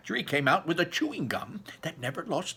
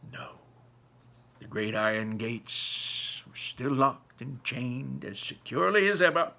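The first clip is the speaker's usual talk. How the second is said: plain reading voice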